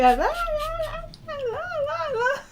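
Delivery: very high-pitched mock scolding babble; mock telling-off. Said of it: "she's gonna go".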